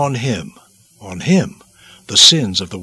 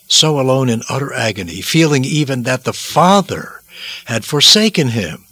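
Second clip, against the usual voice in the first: ordinary delivery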